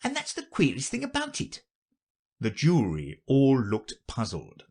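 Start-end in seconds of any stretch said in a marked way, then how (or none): 0.0-1.6 high-pitched rabbit reporting; high, light courtier rabbit. Acted. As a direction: explain in a very high voice.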